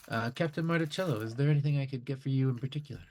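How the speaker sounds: a light, high, quick eager waiter voice; brisk and keen to please. Acted. Waiter asks the captain for his order, lightly voiced.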